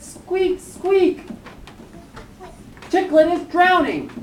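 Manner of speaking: high, panicky squeaking; alarmed little mouse. Acted. Teeny Mouse warns that Chicklet is drowning, high squeak.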